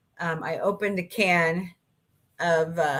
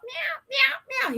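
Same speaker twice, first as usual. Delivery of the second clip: high squeaky imitated meowing; kitten meows; slower than usual. Copying the lost kitten in the bushes, high squeaky meows. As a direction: mew like a scared little kitten. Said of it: "hear Boots screaming his little head off".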